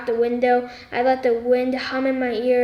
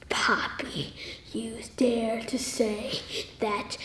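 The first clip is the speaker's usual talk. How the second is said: seething whisper; 2 semitones lower, 10 dB quieter, slower than usual. Retold or imitated. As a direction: hiss the threat under your breath. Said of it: "like, seething angry".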